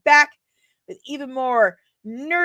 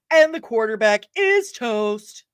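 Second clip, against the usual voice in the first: slow, projected delivery, drawn-out IS; announced with gusto; 4 dB louder than usual. Acted. Streamer showing off a good quote, slow and projected. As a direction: call it out slowly, stretching IS. Performing a film quote, first take.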